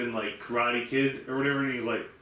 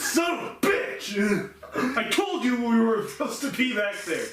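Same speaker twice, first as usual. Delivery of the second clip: laughing mock rant; 9 semitones higher, 3 dB louder than usual. Imitating the manager to his friend, laughing mock rant. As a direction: rant like the clerk while cracking up.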